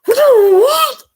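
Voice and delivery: very high, loud squawk of a call; whistling stranger. Retold or imitated. A stranger outside the liquor store calling out, very high and loud. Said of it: "He's like".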